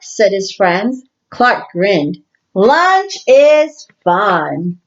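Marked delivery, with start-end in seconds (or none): 2.5-4.8 slow happy line. Acted.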